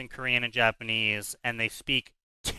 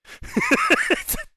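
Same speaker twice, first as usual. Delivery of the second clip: high squealing laugh; 3 dB louder than usual. Real, not acted. Laughs after own joke.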